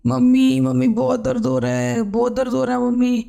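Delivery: high, strained cries of labour pain; crying out in pain. A narrator's reading. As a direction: call out to mother through sharp pain. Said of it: "Sanjana दर्द से तड़प रही थी". (दर्द से कराहती हुई पुकार)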